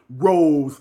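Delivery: loud imitated shout of a name; retold yelling. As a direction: call it out like an exasperated boyfriend. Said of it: "He keeps yelling".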